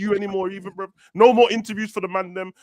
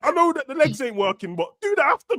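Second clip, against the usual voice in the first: much higher, indignant half-joke; joking rant; 9 semitones higher than usual. Real, not acted. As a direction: protest high-pitched in mock outrage.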